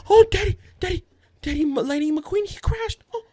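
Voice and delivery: high, gasping mock-distressed child; dramatic little girl. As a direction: imitate a kid overreacting to a crash. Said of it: "She's hella dramatic".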